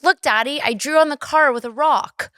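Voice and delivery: put-on high-pitched little kid proudly showing off to daddy; Ziggy. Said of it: "Ziggy's like".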